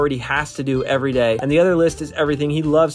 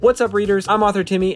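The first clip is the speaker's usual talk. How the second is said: ordinary delivery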